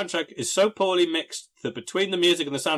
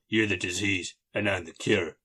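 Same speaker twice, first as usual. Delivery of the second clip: low tough-guy quote; 7 semitones lower, 5 dB quieter than usual. Retold or imitated. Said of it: "to a crook".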